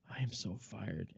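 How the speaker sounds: soft, slow, quiet dread; sinking realization; dread whisper to self. Retold or imitated. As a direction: mutter it under your breath. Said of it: "I thought to myself".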